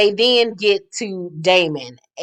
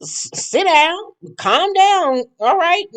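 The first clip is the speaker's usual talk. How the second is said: high, exasperated mock scold; Andy losing patience; 13 semitones higher than usual. Mimicking Andy telling Quad to calm down, high and exasperated. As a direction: squeal it out like a fed-up host. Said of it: "And Andy was like".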